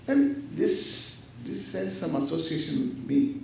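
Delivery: ordinary talk, nothing marked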